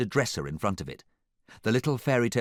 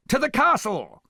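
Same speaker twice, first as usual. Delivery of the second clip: high, loud command; ringing villain's battle order; shouted order; 7 dB louder than usual. A narrator's reading. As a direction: order the troops to charge. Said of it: "he ordered".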